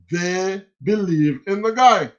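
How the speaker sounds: ordinary talk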